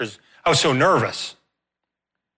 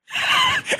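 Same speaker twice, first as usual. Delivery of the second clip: burst of laughter. Real, not acted. Relief at being released.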